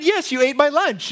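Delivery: retold accusation, pitched well up; loud accusation. Coworker accusing the speaker over a lunch, retold as high mock-loud. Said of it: "she said it very loudly".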